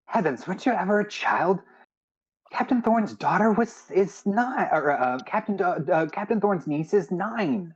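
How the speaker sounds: high-pitched, scolding character voice; Sela, exasperated. Acted. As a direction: pitch it high, as if astonished they don't know. Game master voices Sela.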